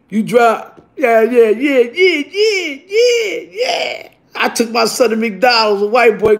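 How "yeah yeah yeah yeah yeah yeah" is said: excited yeahs. Retold or imitated. Driving off happy, celebrating.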